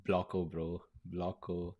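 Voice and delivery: very low, soft, sighed musing; hushed sigh. Real, not acted. Host marvelling at Blocko to himself, hushed sigh. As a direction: sigh it out quietly, almost to yourself. Sighing in awe after watching Blocko.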